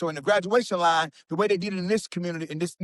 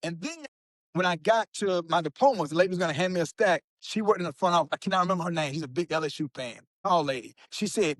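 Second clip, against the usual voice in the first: ordinary delivery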